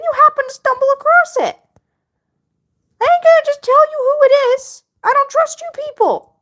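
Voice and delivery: sass the chat in a squeaky voice; high mock-sassy character voice. Teasing viewers about commenter.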